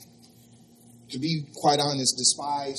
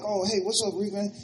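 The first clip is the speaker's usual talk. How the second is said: casual greeting; 6 semitones higher than usual. Retold or imitated. His own reply, acted.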